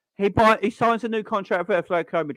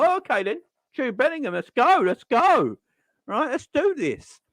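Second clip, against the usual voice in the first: mock excited fans; 6 semitones higher than usual. Fans hearing the Bellingham news, excited and higher.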